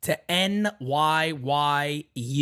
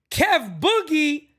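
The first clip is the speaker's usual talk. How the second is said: high, slow, stretched name call; stretched hype shout-out; hyped drawn-out shout-out; 11 semitones higher, 3 dB louder, much slower than usual. Real, not acted. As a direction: call his name out long and big.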